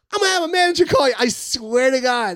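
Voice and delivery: high, loud burst, stretched at the end; excited promise. Retold or imitated. Emcee promising young comic a break, excited promise. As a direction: rush the promise, then draw out the oath.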